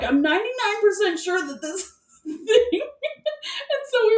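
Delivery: can't keep a straight face; laughing. Real, not acted. Laughing at own obsession.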